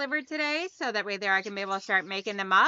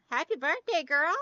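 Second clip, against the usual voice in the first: higher, bright greeting to a friend; cheerful birthday shout-out to a viewer; excited birthday wish; 6 semitones higher than usual. Real, not acted.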